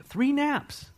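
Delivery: high-pitched incredulous "Three naps"; comic high exclamation; mock amazement. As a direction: squeak out "Three naps" in disbelief. Mock amazement at disciples sleeping.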